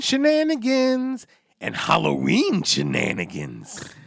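high, slow goofy singsong; silly drawn-out voice. Pretend. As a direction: stretch it out like a cartoon. Cohost's silly drawn-out interjection.

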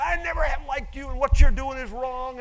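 loud and high, hammed-up fury; mock angry rant. Mocking keyboard warriors with a loud, high fake rant. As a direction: bark it like an all-caps email. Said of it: "They start going".

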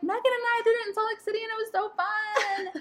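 high, whiny, fast then dragging; whiny pleading. Retold or imitated. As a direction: plead like a begging child. Pleading with husband.

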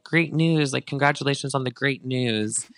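sarcastic, sing-song well-wishing; a teasing fan's fake-happy congrats; mock congratulations. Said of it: "someone tweeted at her being like".

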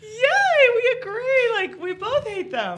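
very high, loud, laughing cheer; mock cheer. Couple mock-celebrating hating their kids, laughing squeal. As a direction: squeal it like a victory. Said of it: "And we were like".

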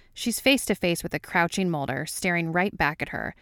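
ordinary delivery, nothing marked

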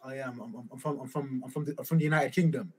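usual speaking voice

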